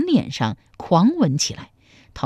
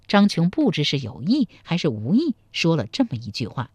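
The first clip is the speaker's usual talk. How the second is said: ordinary delivery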